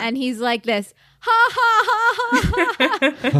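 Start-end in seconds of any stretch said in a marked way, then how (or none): 1.2-3.4 high, breathy villain laugh; evil chuckle. Retold or imitated. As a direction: cackle like a corny charming villain. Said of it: "That's how he laughs".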